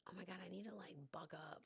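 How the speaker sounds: barely audible whisper; real hushed, emotional self-talk; whispering to oneself. Real, not acted. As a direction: whisper it to pull yourself together. Collecting herself after getting emotional.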